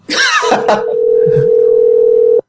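squealing burst of laughter; high shriek laugh. Real, not acted. Laughing hard as they call again, squealing. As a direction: let out a high helpless squeal.

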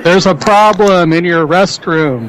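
over-enunciated for someone hard of hearing; loud, slow repeat. Pretend. Repeats for caller who can't hear.

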